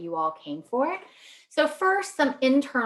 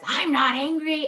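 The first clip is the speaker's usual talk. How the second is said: higher-pitched mock grandma protest; loud denial; 7 semitones higher, 3 dB louder than usual. Grandma answering relative's accusation, mock loud protest. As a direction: insist on it like a hard-of-hearing elder, mildly raised voice. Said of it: "our elders are speaking really loudly".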